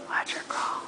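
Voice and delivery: hushed whisper to the camera. Real, not acted. Whispered aside while filming.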